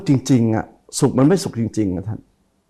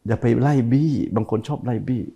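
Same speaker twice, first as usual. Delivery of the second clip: ordinary delivery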